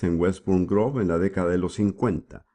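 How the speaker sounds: normal talking voice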